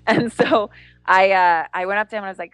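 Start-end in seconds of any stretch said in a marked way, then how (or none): none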